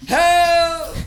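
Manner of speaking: long high loud help; mock scream for help. Pulled into the fray, wailing to friends for help. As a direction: wail it out from far off.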